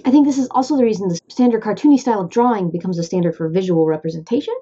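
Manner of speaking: normal talking voice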